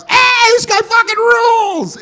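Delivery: very high, drawn-out cheering yell; crowd cheer. Retold or imitated. Imagined partygoers hail the kid, cheering. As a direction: whoop it like an adoring crowd.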